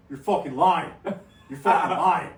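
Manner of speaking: loud laughing incredulity; excited disbelief. Retold or imitated. Telling Justin the news, loud and laughing. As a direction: shout it twice, cracking up. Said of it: "I'm like".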